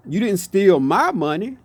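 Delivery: ordinary talk, nothing marked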